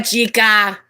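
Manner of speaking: usual speaking voice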